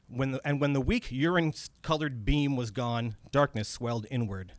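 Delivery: ordinary delivery, nothing marked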